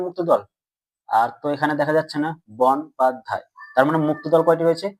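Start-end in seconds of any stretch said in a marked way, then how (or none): 2.6-3.4 বন, পাত, ধায় as three separate beats; syllable by syllable. Shown as a demonstration. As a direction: break the word into বন, পাত, ধায়. Teacher splits word into syllables.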